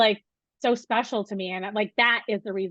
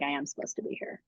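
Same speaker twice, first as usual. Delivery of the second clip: soft, lower murmured affirmation; quiet self-reassurance; 6 semitones lower, 11 dB quieter than usual. Retold or imitated. Professor reassuring herself she belongs, soft and low. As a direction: say it quietly, as if convincing yourself.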